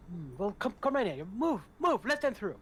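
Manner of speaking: high, excited servant voice; a high-pitched, fussy servant, startled and eager; flustered major domo. Acted. Major domo orders the guards.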